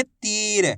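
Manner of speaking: "तीर" with the ई held long; long drawn-out ī. Shown as a demonstration. Teacher showing how to read the word, "तीर" drawn out. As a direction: stretch the vowel before र in "तीर". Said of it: "आइए समझ में कैसे पढ़ेंगे".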